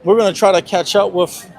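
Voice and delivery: loud voice over crowd noise; talking over the crowd. Real, not acted. Reporter at loud protest.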